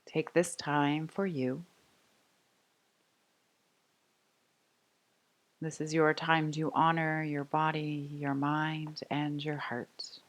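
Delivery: soft, unhurried meditation voice with long pauses; guided meditation. Real, not acted. Yoga teacher inviting the class to rest, calm guided meditation. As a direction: settle them gently into noticing the breath.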